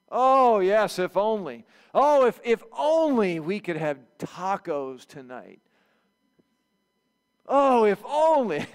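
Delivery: loud, slow, drawn-out longing; hammed-up wish so someone takes the hint; hinting sigh. Someone longing aloud for tacos, hoping to be heard. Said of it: "Have you ever said something".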